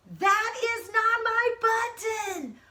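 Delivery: high, slow, drawn-out wail. Acted. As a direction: wail it like a heartbroken toad. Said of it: "wailed Toad".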